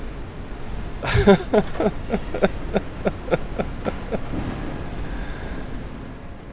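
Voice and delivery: long, high, wheezy laugh; laughter. Real, not acted. Storyteller amused at having pranked a friend, laughing. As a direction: keep laughing till it turns breathless.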